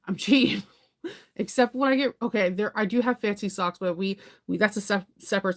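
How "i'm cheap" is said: laughing. Real, not acted. Laughing at own thriftiness.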